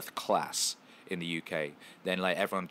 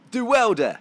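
very high, loud mock yell; British clubber's shout; 12 dB louder than usual. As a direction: holler it like rowdy British lads. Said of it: "And they're like".

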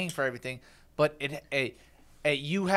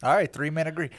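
usual speaking voice

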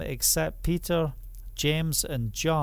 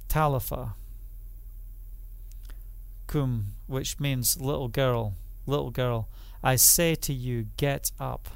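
softer and lower, gentle; tender call; 4 semitones lower, 5 dB quieter than usual. Acted. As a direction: wake a sleeping child gently. Tender words to the girl.

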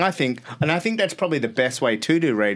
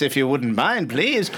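usual speaking voice

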